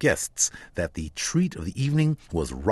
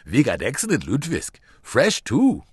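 voicing a cheerful Swedish shopkeeper, higher and louder; a lightly done Scandinavian grocer boasting, higher and louder; shopkeeper Ingmar; 4 semitones higher, 5 dB louder than usual. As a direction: play the proud old Nordic grocer, quick and bright.

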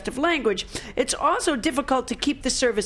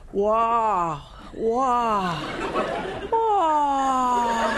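wailing wah; much slower than usual. Acted. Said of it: "It sounds something like".

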